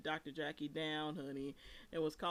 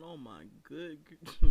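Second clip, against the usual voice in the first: louder, drawn-out shocked exclamation; shocked gasp; 2 semitones lower, 12 dB louder, slower than usual. Real, not acted. Host reacting to the rant, drawn-out shocked cry. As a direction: cry out in disbelief at the clip.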